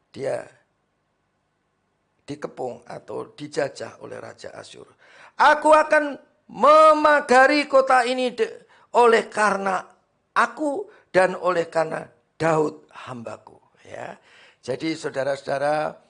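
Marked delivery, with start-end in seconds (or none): 5.4-13.6 loud, high scripture reading; full-force preaching. Acted. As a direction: proclaim it to the congregation. (membaca ayat dengan suara khotbah lantang)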